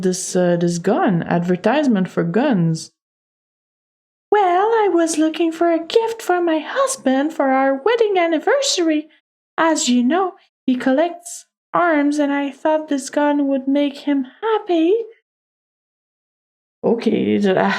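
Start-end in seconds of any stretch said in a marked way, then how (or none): 4.3-14.9 high-pitched lady explaining herself; composed lady suspect. Acted.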